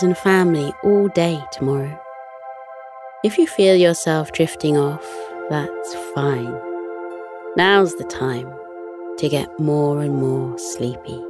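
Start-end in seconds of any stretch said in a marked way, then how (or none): none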